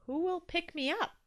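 high, anxious question; worried. A narrator's reading. Toby asking who will collect him, worried. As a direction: ask it with mild worry. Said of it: "Toby asked worriedly".

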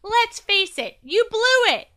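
high, loud gloating shout; taunting shout. A narrator's reading. Will zooming down the slide, shouting at the nose. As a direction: shout it as a jeer. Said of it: "Will shouted".

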